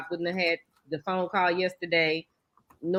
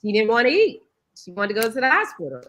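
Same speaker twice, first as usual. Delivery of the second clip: ordinary talk, nothing marked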